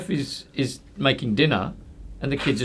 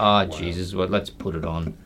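lower, softer resigned sigh; weary giving in; 6 semitones lower, 4 dB quieter than usual. Retold or imitated. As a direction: give in like a worn-out dad. Father giving in to kids.

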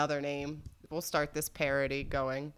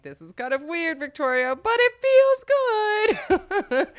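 higher, drawn-out ending; high put-on voice; 13 semitones higher, 9 dB louder, slower than usual. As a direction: coo it to yourself, slowing at the end. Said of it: "and I'm like".

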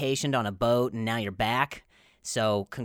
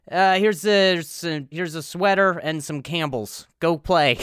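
stepdad voice; 3 semitones higher, 7 dB louder than usual. Retold or imitated. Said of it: "invented by a stepdad who hates his kid".

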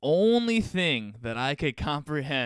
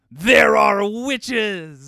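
loud, high dramatic cry; hammed-up shout; mock alarm; 9 semitones higher, 12 dB louder, much slower than usual. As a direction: stretch it out as a horrified child's big realisation. Child's conclusion, retold in mock alarm.

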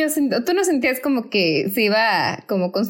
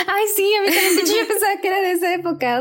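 laughing; 11 semitones higher, faster than usual. Real, not acted. Excited recognition, laughing.

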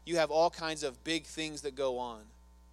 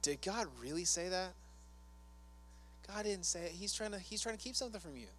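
lightly voiced sly tempter, softer and a bit higher; a hint of a whispering tempter; Satan the tempter; 3 semitones higher, 8 dB quieter than usual. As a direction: coax doubt in like a quiet serpent. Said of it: "What did Satan say?".

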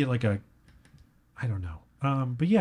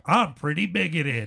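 higher, hammed-up soundbite delivery; mock station drop, pitched up; radio drop; 4 semitones higher than usual. Shown as a demonstration. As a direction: punch it out like a jingle tag. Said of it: "I don't want you using that as a drop".

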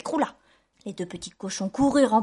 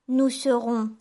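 lightly voiced little pig, louder; confident little pig; 2 semitones higher, 6 dB louder, slower than usual. A narrator's reading. Little pig to his brothers, confidently. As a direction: announce it as a proud piglet.

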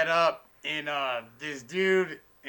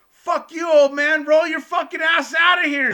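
much higher, much louder screaming; retold furious yell; 11 semitones higher, 9 dB louder than usual. Waitress throwing the old man out of the bar, furious yell.

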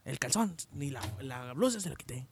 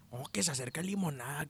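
normal talking voice

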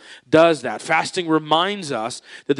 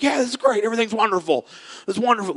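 put-on gleeful child; 8 semitones higher, much faster than usual. Pastor voicing happy child with ice cream.

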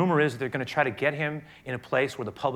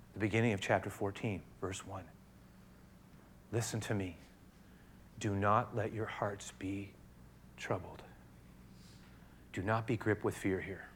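low, quiet reading of the verses; hushed pulpit reading of the Bible; soft scripture reading; 4 semitones lower, 9 dB quieter than usual. Acted. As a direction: read the passage gently and hushed. Reads scripture softly to congregation.

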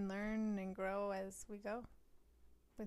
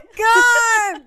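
loud, high delighted shriek of laughter; screaming with laughter; 11 semitones higher, 18 dB louder than usual. Real, not acted. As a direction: burst out laughing in joyful recognition. Recognising a beloved astrologer.

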